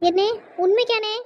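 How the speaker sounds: loud, high, joyful disbelief; surprised delight. Acted. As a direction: burst out in happy surprise. Sister hears brother's promise. (அண்ணன் வாக்கைக் கேட்டு ஆச்சரியத்துடன் உரக்க)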